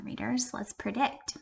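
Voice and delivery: soft unvoiced "readers"; whispered question. Real, not acted. Teacher to class, whispered question. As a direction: lean in and whisper. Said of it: "whispered aside to class".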